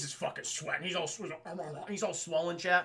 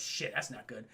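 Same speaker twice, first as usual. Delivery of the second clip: low and quiet; 8 semitones lower, 7 dB quieter than usual. Retold or imitated. Bruce reacting to grim news.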